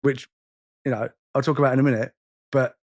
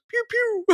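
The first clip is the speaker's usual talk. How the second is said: tiny high-pitched "pew pew"; soft, squeaky Space Invader zaps; imitated laser noise; 5 dB quieter than usual. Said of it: "the guy goes".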